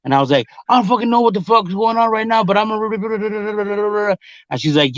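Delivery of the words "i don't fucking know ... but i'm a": fast, high-pitched replay of ranting; retold worked-up rant. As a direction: rattle it off worked up, then trail into babble. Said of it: "she could tell I was on go".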